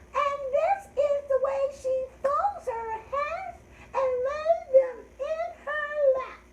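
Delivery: very high, loud grandma squeak; sky-high sing-song rhyme voice; high-pitched voice. Shown as a demonstration. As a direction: recite slowly in a squeaky little falsetto. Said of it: "use your high-pitched voice".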